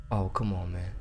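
ordinary talk

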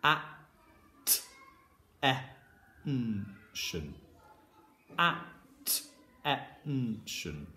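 "At-, e-, n-tion" in separate sounds with gaps; sounding out. Shown as a demonstration. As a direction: break "Attention" into its sounds, softly. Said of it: "I'm gonna read this one out now".

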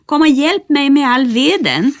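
high, loud call; shouting to Nisse. Acted. Bertil shouts down for help carrying the firewood, loud. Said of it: "skrek till Nisse".

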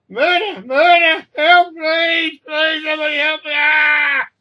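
high, very loud shrieks of murder; screaming in panic. A narrator's reading. Woman clawed by a cat begs for help, screaming in panic. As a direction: shriek for rescue in pain and panic. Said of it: "This woman is screaming more in, in panic".

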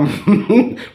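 high little chuckle. Real, not acted. Vlogger joking through a list of names, chuckle.

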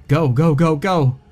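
loud, high panicked scream. A narrator's reading. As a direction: scream it in terror. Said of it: "Thomas caught his breath and screamed".

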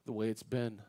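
very soft, low plea to God; tearful prayer. Retold or imitated. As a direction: pray it quietly, as if retelling a tearful moment. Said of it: "when I'm sitting there crying".

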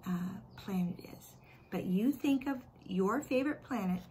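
usual speaking voice

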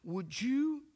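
a mock outraged mother; upset wife. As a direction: protest as the alarmed wife. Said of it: "She said".

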